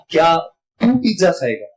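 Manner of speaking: तू set apart after a pause and leaned on; stress on तू. Shown as a demonstration. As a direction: underline the word तू, pausing just before it. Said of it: "मेरे दोनों वाक्य same थे, सिर्फ underline". (तू शब्द पर ज़ोर)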